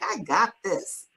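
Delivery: slow, self-assured brush-off; mock confidence, drawn out; cocky. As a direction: play it like you've got it handled. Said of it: "I'm like".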